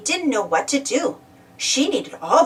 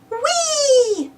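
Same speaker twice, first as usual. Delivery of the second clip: high, delighted whee on the swing; cry of joy; 13 semitones higher than usual. A narrator's reading. Purple Kangaroo on the swing cries out, high and delighted. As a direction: let out a gleeful swing cry. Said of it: "he cried".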